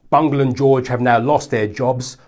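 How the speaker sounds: normal talking voice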